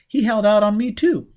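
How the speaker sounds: she's been betrayed too; furious outburst. Acted. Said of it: "she was furious too".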